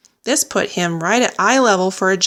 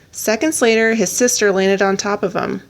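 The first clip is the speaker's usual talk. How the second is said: usual speaking voice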